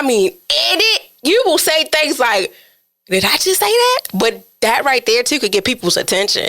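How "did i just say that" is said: high, rising disbelieving question; mock shock. Host replaying a slip while podcasting, high mock shock. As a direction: replay your own startled disbelief. Acts own shock at slipping.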